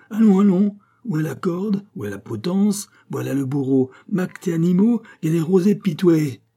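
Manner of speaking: high, loud theatrical exhortation; acted exalted outburst; frenzied goading. A narrator's reading. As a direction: egg him on in mock martyrdom. Abbé mockingly eggs on his executioner.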